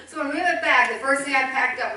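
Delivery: a loud burst of laughter as she speaks; laughing. Real, not acted. Laughing at packing the wedding dress.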